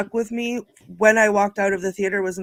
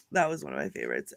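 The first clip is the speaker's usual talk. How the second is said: ordinary talk, nothing marked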